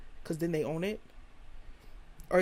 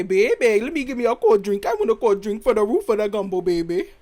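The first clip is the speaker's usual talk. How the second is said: put-on New Orleans accent; 9 dB louder than usual. Imitating people down in New Orleans, loud mock New Orleans accent. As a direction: holler it like an exaggerated New Orleans local. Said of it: "If we go down to New Orleans and they say".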